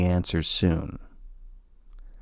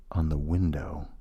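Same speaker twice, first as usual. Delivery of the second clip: quiet, wary and lowered voice; hushed unease; 2 semitones lower, 9 dB quieter than usual. Acted. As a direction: speak softly, as if listening for the tapping. Alone at night, tapping at window.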